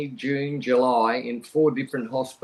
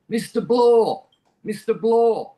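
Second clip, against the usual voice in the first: imitating a doctor's loud call, higher; doctor yelling his name; 7 semitones higher, 6 dB louder, slower than usual. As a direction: call out the name like a doctor trying to rouse a patient. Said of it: "I could just hear this guy going".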